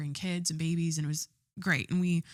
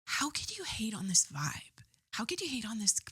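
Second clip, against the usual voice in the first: whispered disbelief; 2 semitones higher, 6 dB quieter than usual. Retold or imitated. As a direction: breathe the question out in quiet disbelief. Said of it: "I'm like".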